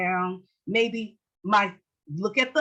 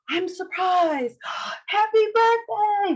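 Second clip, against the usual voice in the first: high, drawn-out gasping delight; hammed-up happy surprise with a smile; surprised; 12 semitones higher, slower than usual. Shown as a demonstration. Teacher demonstrating surprise at a birthday, hammed-up surprised. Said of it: "with a smile".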